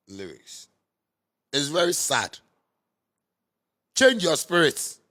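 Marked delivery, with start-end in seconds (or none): 3.9-5.0 slow, loud and high, pleading hard; loud plea. Real, not acted. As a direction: call it out slowly like a preacher. Preaching plea to listeners.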